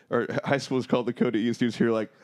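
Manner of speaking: ordinary talk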